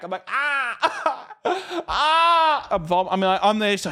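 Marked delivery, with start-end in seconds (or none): 0.3-2.7 high-pitched cries of pain; hammed-up wailing after a blow; imitated pained wail. Acts out being hit, falling.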